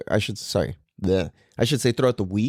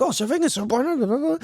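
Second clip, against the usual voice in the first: put-on much higher, gushing small-boy voice; little-kid voice; 12 semitones higher than usual. Dad imitating his son after surfing, high gushing kid voice. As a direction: gush it like a happy three-year-old. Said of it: "he's just like".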